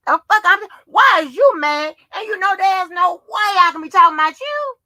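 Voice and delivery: loud, high, exasperated challenge; indignant retort. Acted. Arguing back at absent JoJo.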